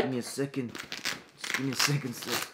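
low, soft, mumbling schoolboy; timid student. Acted. Shy boy answering a pushy teacher, soft and low. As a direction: shrink away from the teacher, quietly.

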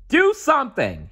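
high desperate call. A narrator's reading. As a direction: shout to the nephews from the rock. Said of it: "he called to his nephews".

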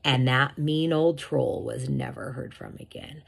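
ordinary delivery, nothing marked

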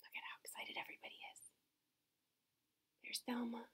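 fast, voiceless whisper; excited whisper. Real, not acted. Showing the pages to kids, whispered. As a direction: share it like a thrilling secret.

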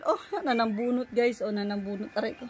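usual speaking voice

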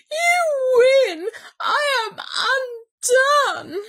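slow, high, loud wail; blubbering. A narrator's reading. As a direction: wail in total surrender. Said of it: "he blubbered".

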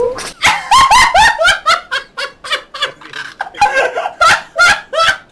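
long, high, loud laughing fit; helpless cackling that keeps going; uncontrolled laughter. Real, not acted. Cracking up at the voices.

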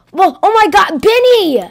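high, loud cry of alarm; alarmed shout. Acted. Seeing Benny throw things, shouts at him in alarm. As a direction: yell out at Benny in shock.

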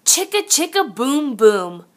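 usual speaking voice

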